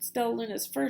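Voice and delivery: usual speaking voice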